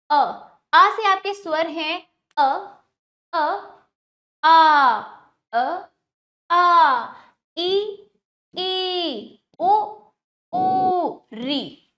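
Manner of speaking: put-on each vowel called out clearly for children; teacher reciting vowels. Shown as a demonstration. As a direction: model every vowel loud and distinct for the class. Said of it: "अब मेरे साथ बोलिए".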